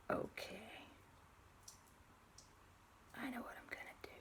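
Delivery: very soft conspiratorial whisper; secretive whisper. Acted. Eavesdropper secretly hatching a plan, hushed whisper.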